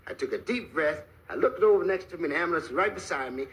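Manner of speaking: ordinary talk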